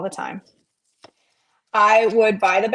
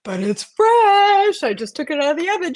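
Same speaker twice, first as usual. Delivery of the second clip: imitating the bread lady; 8 semitones higher, 4 dB louder than usual. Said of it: "She was like".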